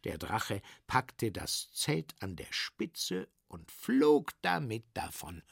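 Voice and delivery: plain reading voice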